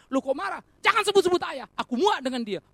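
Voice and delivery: acted angry shouting, high and loud; angry outburst. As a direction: snap back in mock fury. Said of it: "marah-marah".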